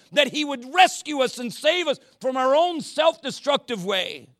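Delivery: high, loud, driving sermon climax; full-force pulpit preaching; impassioned preaching. Acted. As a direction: preach it hard, rising to a peak.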